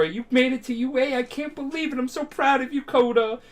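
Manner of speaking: high, gushing mom voice; imitated high-pitched maternal delight; overjoyed proud mom. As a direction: gush like a mom jumping for joy. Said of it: "his mom is, like, jumping for joy".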